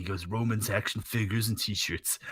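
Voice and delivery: a lightly voiced Triple H laying it out; Triple H impression. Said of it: "That is kind of a good Triple H".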